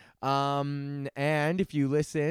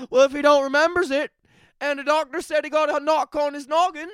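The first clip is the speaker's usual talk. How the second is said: high, loud mock Newfoundland accent; bad Newfie accent; 8 semitones higher, 6 dB louder than usual. Judge excusing the driver for a knock on the head, bad Newfie accent. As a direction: pitch it up in a clumsy Newfie accent. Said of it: "that's a pretty bad Newfie accent".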